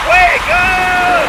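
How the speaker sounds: high shouted wake-up call; Radio Raheem. Retold or imitated. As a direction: yell it like waking the whole block. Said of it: "The great Radio Raheem from Do the Right Thing".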